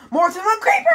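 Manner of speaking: high, loud outburst; alarmed yell. Real, not acted. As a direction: shriek it in rising alarm.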